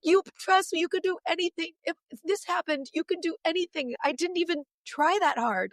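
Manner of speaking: excited mom to daughters; excited urging. Retold or imitated. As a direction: tell them with excited conviction. Exhorting daughters.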